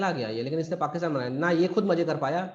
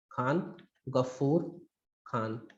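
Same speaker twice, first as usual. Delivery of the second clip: Khan Ghafoor Khan word by word, low and soft, with pauses; slow dictation; 3 semitones lower, 5 dB quieter, much slower than usual. Shown as a demonstration. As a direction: spell out Khan Ghafoor Khan very slowly for note-takers. Name repeated slowly for students.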